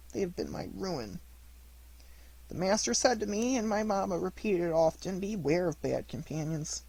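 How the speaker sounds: high, tearful pleading; crying in despair. A narrator's reading. Pinocchio crying over his fallen schoolfellow, high and tearful. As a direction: beg him to wake, breaking with grief. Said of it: "crying bitterly in his despair".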